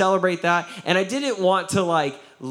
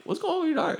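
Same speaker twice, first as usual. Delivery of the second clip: meek squeaky voice; 13 semitones higher, 3 dB quieter, much faster than usual. Retold or imitated.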